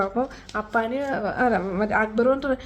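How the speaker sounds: normal talking voice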